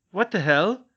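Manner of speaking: loud, high startled outburst. Acted. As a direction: jolt at the laughter. Neville startled by mad laughter.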